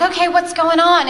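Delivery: a mother still alarmed, pressing her son for news; anxious mom. Retold or imitated. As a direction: demand to know what's wrong.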